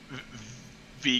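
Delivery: ordinary delivery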